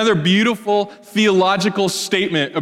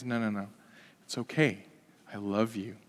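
softly reassuring, much quieter; tender reassurance; 7 semitones lower, 14 dB quieter than usual. Retold or imitated. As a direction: hush her fear gently, like consoling a frightened child. Jesus comforting the woman.

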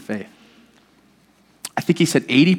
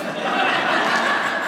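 breathy laugh; 5 dB quieter than usual. Real, not acted. Laughs at his own line.

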